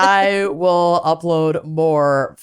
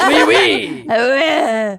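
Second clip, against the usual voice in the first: hammed-up French accent; mock French caller; 5 semitones higher than usual. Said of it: "maybe vaguely French".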